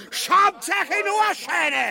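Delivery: hoch und laut, langsam herausgeschrien; kreischend gebrüllt. Acted. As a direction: die Gegner anbrüllen. Ubu schreit im Kampf. (Kampfschrei, viel höher und lauter)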